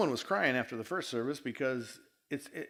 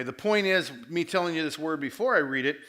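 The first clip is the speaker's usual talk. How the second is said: usual speaking voice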